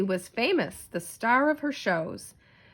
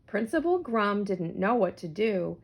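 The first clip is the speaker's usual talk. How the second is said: ordinary delivery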